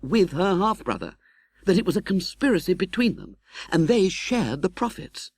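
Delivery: put-on young man telling a tale. Young friend recounting Miss Havisham's past to Pip, lightly voiced. Herbert continues answering.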